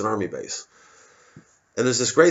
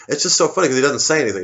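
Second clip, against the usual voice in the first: ordinary talk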